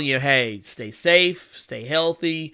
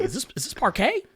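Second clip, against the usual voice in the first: soft, high-pitched puzzled questions; mock bafflement; 8 semitones higher, 5 dB quieter than usual. Host voicing Tobey not believing it's not butter, mock baffled. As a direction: ask it softly, climbing higher in disbelief.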